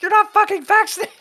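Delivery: high-pitched, loud mock outburst; hammed-up incredulous yell; losing it. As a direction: shriek it in outrage, imitating her. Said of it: "And she lost it".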